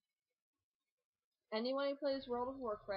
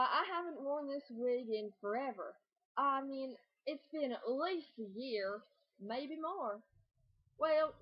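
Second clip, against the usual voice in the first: put-on southern accent; 3 semitones higher than usual. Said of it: "who has a southern accent no matter what you say".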